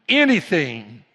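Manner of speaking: ANYTHING burst out loud, falling in pitch; emphatic cry. Real, not acted. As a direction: hit "anything" hard and loud. Preacher repeats the word for emphasis.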